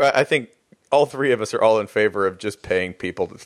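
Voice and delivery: ordinary delivery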